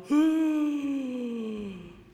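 loud, high long gasp; big comic horrified gasp; shocked gasp. Retold or imitated. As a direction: gasp in mock horror. Hearing alcohol is included.

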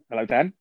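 Hello, Dan with a gentle questioning rise on Dan. Retold or imitated. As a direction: mimic the puzzled phone greeting, lifting at the end on Dan. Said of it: "he'd always have, like, a quizzitive answer".

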